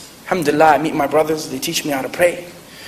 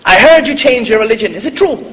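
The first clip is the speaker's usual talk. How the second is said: imitating an Irish grandmother, louder and higher; grandma's Irish lilt, quizzing her grandson; Irish accent; 6 semitones higher, 5 dB louder than usual. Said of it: "she goes in her Irish accent".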